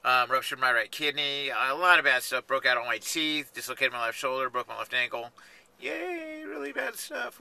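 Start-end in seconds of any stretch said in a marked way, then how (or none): 5.8-7.4 small, high, soft mock "yay"; tiny high-pitched fake celebration; mock cheer. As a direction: cheer sarcastically in a high, quiet voice. Mock cheer about terrible injuries.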